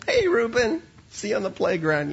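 high, loud hello to a passing pupil; friendly calling out. Retold or imitated. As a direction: call out cheerily to the boy. Calls to boy passing the door.

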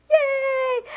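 high loud cheering yay. Shown as a demonstration. Teacher showing preschoolers how to cheer, excited. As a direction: shout it up high like a thrilled kid. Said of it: "I'll be like".

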